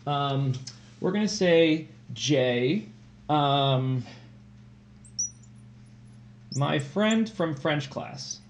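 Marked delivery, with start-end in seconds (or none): 2.1-8.4 slow dictation. Shown as a demonstration. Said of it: "let me say it's about Jay".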